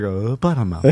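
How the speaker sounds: low, soft mock discovery; hushed low kid mumble; quiet retold realization. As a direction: imitate boys murmuring it as it dawns. Retold boys looking up, remembering.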